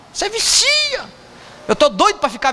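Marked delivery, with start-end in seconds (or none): 0.1-1.1 a much higher, squeaky echo of "vicia"; high squeaky repeat. Shown as a demonstration. Pastor echoing his friend to the congregation in a high mock squeal. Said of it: "Né, Vantuildo?".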